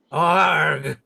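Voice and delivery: loud mock groan; a sudden loud groan of protest. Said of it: "said Ivan".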